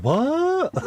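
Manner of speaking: high, baffled what, imitated; puzzled what. Imagining a viewer reading the patron message, mock baffled. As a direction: play the viewer lost at the message. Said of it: "he'd be reading that going".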